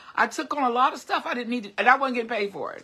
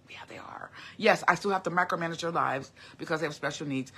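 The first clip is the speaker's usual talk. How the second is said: soft, whispered admission; whispered aside to viewers; 4 semitones lower, 5 dB quieter than usual. Real, not acted. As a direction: drop to a whisper as if confessing. Quiet aside admitting a worry.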